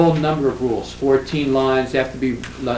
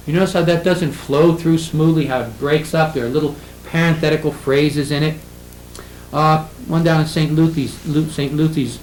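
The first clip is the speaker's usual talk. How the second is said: normal talking voice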